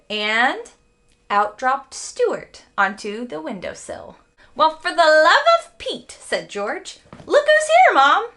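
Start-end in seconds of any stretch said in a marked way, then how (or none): 4.6-6.2 surprised. A narrator's reading. George finds Stuart, surprised. 7.3-8.3 excited call. A narrator's reading. George calls mother excitedly.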